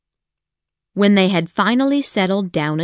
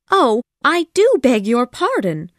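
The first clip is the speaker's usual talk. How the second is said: higher, louder flustered apology; worried; 5 semitones higher, 4 dB louder than usual. A narrator's reading. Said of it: "she said worriedly".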